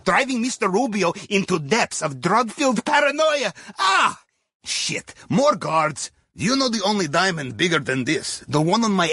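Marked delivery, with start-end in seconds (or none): 3.8-4.2 startled yelp. Real, not acted. Sudden alarm during the heist. 4.6-5.1 whispered curse. Real, not acted. Quick curse as guards appear.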